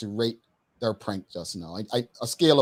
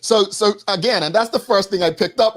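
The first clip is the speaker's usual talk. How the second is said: high, louder burst of enthusiasm; excited; 9 semitones higher, 7 dB louder than usual. Real, not acted. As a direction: jump in eagerly. Host excitedly jumps in.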